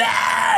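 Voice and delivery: very high screech of a movie freak; shrieking monster impression; imitated monster scream. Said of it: "that screaming freak comes out".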